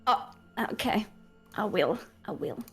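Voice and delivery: ordinary talk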